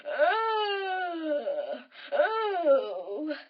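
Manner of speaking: big drawn-out yawning oh; long, sliding yawn; lazy crocodile yawn. Acted. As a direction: yawn wide like a basking croc. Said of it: "snapping his jaws rather lazily".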